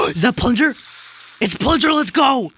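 high, loud excited shouting; screaming with excitement; thrilled yelling. Real, not acted. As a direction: erupt like a fan spotting a favourite. Thrilled by a favourite character appearing.

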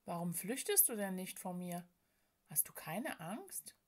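very soft questioning, fading to a whisper; hushed suspicion. A narrator's reading. Sadness asking why the woman stays, hushed and wary. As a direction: ask warily, almost under the breath. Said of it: "argwöhnte die Traurigkeit".

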